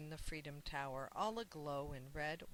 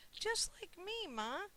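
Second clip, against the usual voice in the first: put-on much higher, eager child's cry, slowing on the last words; excited exclaim; 12 semitones higher, slower than usual. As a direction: blurt it out, thrilled to be like the tree. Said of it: "exclaimed Sam".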